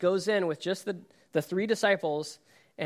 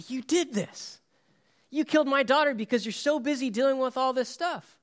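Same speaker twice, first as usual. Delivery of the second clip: higher, anguished accusation; blaming Jesus; 8 semitones higher than usual. Pretend. Grieving father accusing Jesus of his daughter's death, anguished cry. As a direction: hurl the blame at him.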